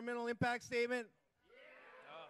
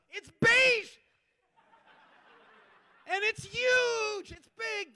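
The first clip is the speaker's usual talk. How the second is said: mock outrage; 9 semitones higher, 8 dB louder, slower than usual. Real, not acted.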